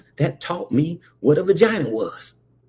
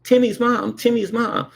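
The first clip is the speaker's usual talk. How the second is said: put-on higher, pleading little-kid call; kid tugging for attention; 9 semitones higher than usual. Boy tugging mom's skirt, higher kid call. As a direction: call her like a small child tugging her skirt.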